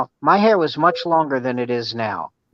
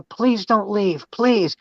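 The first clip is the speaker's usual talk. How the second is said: mock pleading; 6 semitones higher, slower than usual. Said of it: "they go".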